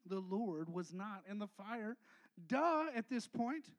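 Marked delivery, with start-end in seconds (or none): 0.0-2.0 slow, dropping the voice; a quiet, slow contrast to the boom; softer and lower. Acted. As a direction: bring it down to a soft, slow line. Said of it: "But it says".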